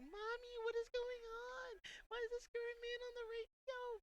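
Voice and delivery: put-on very high, drawn-out little-kid voice; put-on falsetto kid in the back seat; scared child. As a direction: squeak it like a frightened child calling for Mommy. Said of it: "I'm scaring the children in the back seat".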